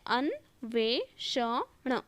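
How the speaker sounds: "अन्वेषण" drawn out as an, ve, sha, na; syllable by syllable. Shown as a demonstration. Teacher spelling out for students, slow and segmented. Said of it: "तो ये वाला हो लगता है".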